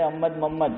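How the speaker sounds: احمد، محمد as rhyming "Ammad, Mammad"; mispronounced names. Retold or imitated. Cleric mimicking slurred names, "Ammad, Mammad". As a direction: run احمد، محمد together with both ha sounds swallowed. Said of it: "کیا بولیں گے؟".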